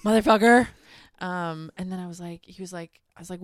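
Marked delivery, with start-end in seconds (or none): 0.0-0.7 a loud mock-outraged swear; loud exasperated curse. Real, not acted. Reacting to her boyfriend's excuse, loud exasperated curse. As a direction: blurt it out loud and fed up.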